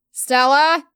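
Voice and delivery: loud call of her name; shouting. A narrator's reading. Emma shouts for Stella while running through the house. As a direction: shout it as you run. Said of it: "continuing to shout at her".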